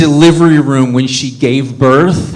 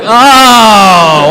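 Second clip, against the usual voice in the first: loud, high, drawn-out acted oh; hammed-up penny-dropping cry; sudden realisation; 8 semitones higher, 7 dB louder than usual. Dad finally understanding the doorman, loud mock realisation.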